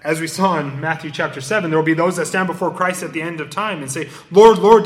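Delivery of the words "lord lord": a crowd begging loudly at the judgment seat; loud plea. Acted. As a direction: cry out Lord, Lord loudly.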